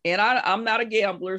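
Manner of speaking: ordinary delivery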